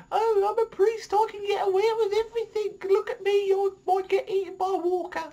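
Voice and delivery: very high, whiny mock voice; mocking whiny priest. Vlogger ridiculing Gabriel's cowardice in a whiny high squeak. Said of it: "And it's like".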